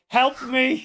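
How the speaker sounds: stranded on an exercise ball, mock pleading; mock cry for help. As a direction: play the stuck pregnant woman calling for help.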